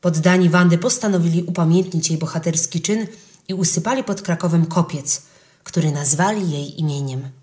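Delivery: ordinary delivery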